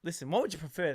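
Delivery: ordinary talk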